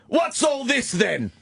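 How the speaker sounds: slow mock British bobby, called out; hammed-up London copper, English accent. British bobby accent teasing the tourists, called out. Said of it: "cute accent".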